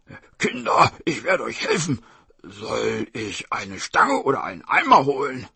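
lightly voiced tiger, calling out; tiger offering help. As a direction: call to the children as a scheming tiger.

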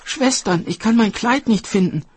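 very high, distressed cry; youngest fairy calling out. A narrator's reading. Youngest fairy calling her sisters over the lost dress, high alarm. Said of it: "rief die jüngste Fee".

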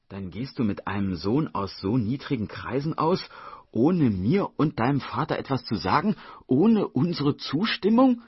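builds from plain to a high, loud indignant shout; mounting outrage. Acted. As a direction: start level and climb into furious disbelief. Mother scolds daughter over boyfriend. (steigende Empörung bis zum lauten Ausruf)

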